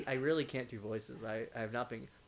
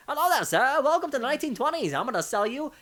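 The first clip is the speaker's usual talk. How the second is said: much higher, loud pushy salesman voice; 11 semitones higher, 11 dB louder than usual. Shown as a demonstration. Demonstrating his one voice, loud high salesman pitch. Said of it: "The only voice I can do is my salesman voice".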